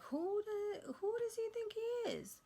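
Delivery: shrill, high indignant squeal; sky-high outrage at the man who grabbed her; outraged disbelief. Retold or imitated. Outraged at how he treated her.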